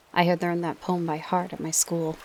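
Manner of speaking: plain reading voice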